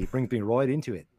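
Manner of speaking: ordinary delivery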